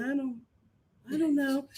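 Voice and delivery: a shy mock "I don't know"; quiet unsure mumble. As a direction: barely get it out, unsure. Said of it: "Sincere was quiet the whole time".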